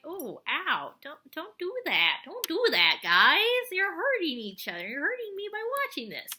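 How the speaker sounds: mock-hurt pleading, rising in pitch; pained, half-playful begging; wincing plea. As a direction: plead with squabbling friends, pitch climbing. Pleading with bickering cartoon characters.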